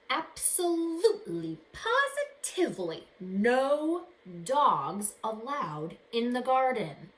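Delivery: booming, slow reading of a no-dogs sign; stern warning. Acted. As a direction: proclaim it like a strict warning sign.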